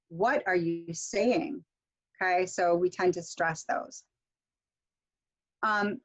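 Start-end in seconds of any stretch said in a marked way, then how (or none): 0.1-1.7 slow, "What" stressed at the start; stressed WH word. Shown as a demonstration. Teacher showing WH-word stress, weight on "What". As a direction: hit "What", then draw out "saying". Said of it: "W H words are stressed".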